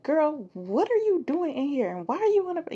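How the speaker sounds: high, scolding grandma voice. Retold or imitated. Grandma finds girl on bathroom floor, high scolding. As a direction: chew her out like grandma. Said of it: "she's like".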